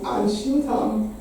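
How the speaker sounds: ordinary talk, nothing marked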